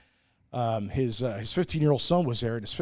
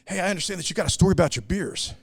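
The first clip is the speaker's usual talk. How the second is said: higher, louder pushy excitement; retold excited guy; 6 semitones higher, 4 dB louder, faster than usual. Pushy festival guest eagerly approaching the brewer, higher and louder. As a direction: barge in eager and pumped. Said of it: "He's, like, real excited".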